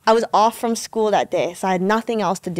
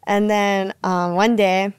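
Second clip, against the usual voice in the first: usual speaking voice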